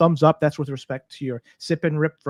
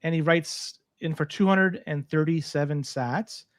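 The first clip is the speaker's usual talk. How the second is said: normal talking voice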